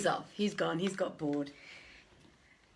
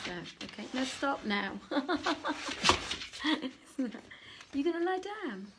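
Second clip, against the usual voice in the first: usual speaking voice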